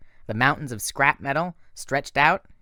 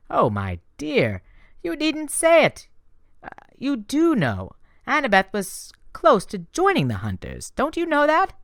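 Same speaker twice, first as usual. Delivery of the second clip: higher, sweetly teasing goddess voice; Aphrodite, sly and gushing; 13 semitones higher, 5 dB louder than usual. A narrator's reading. Goddess teasing Percy about her secret help, higher and sweet. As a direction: purr it like a doting goddess. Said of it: "she said".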